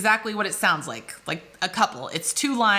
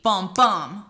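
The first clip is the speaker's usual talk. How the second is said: "bum, bum", second bum punched louder; unstressed-stressed heartbeat; 4 dB louder than usual. Shown as a demonstration. Teacher demonstrating an iamb, stress on second bum. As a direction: thump "bum, bum" like a heartbeat, weak then strong. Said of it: "unstressed, then stressed".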